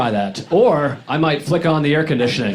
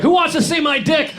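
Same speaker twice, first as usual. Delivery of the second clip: much higher, rowdy yell; wild party shout; 13 semitones higher than usual. Pretend. After a wheatgrass shot, a rowdy drunk-style yell. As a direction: yell it like you just took a shot. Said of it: "I was like".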